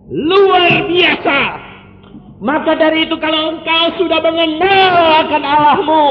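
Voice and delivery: high, loud fervent preaching; full-throated sermon delivery; preaching at full force. Acted. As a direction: proclaim it to the whole church. Preacher exhorting congregation.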